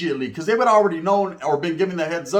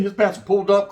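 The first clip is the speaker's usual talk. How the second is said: ordinary talk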